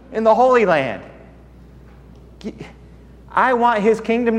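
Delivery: high, loud, fervent testimony; full-force preaching. Acted. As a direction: preach it out to the whole hall.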